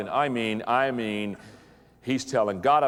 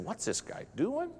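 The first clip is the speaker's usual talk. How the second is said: suspicious congregation; 12 semitones higher, 7 dB quieter than usual. Retold or imitated. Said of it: "They were saying".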